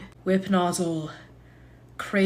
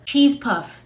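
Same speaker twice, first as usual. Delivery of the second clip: ordinary talk, nothing marked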